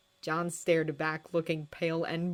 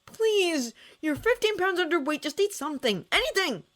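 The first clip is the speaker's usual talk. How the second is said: high desperate pleading; father begging his daughter to eat anything; 12 semitones higher, 4 dB louder than usual. A narrator's reading. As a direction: beg her, voice pitched way up. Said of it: "Jason begged".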